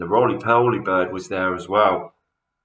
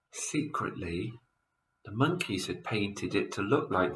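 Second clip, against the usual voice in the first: hushed; 10 dB quieter than usual. A narrator's reading. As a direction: let them in on a secret. Narrator hushes for a secret.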